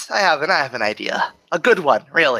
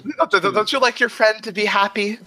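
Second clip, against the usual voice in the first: higher, measured voice of Death; Death's voice; 7 semitones higher than usual. Acted. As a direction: speak as a calm, amused Death.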